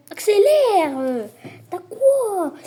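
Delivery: high loud call to speed up; sharp high command, full volume; loud urging shout. Real, not acted. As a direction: yell at the player to hurry. Urging his player on.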